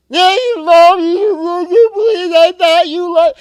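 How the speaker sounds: a daughter wailing at her calm mom; wailing child. Retold or imitated. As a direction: imitate a child howling in a tantrum. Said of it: "She's wailing like she's being tortured".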